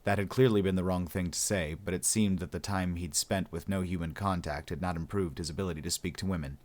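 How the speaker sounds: ordinary delivery, nothing marked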